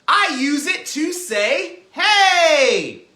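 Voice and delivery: loud, high, stretched-out call; big projected shout. Shown as a demonstration. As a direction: holler it as if calling someone. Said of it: "This is my calling voice".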